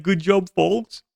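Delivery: slow, mock-patronizing good job; Europeans go. Mocking Europeans praising folks, slow and smug. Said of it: "Europeans go".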